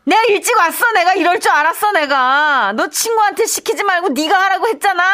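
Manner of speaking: high, loud, fast scolding; scolding shout. Acted. Mother bursting in on her son, scolding him loudly. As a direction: catch him red-handed and yell.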